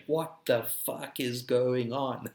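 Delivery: slow, drawn-out what-the-hell disbelief; slow bewildered groan of a question; stunned disbelief. Retold or imitated. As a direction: let each word hang, baffled and fed up. Retelling own stunned 2020 reaction.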